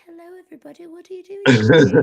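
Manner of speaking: high-pitched mock British greeting; British accent. Fan imitating the horror actor in person, high British accent. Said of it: "he's got this British accent".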